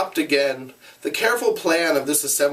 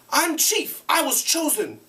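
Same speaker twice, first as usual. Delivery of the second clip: put-on high insistent boy. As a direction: insist on your right. Ralph asserts himself.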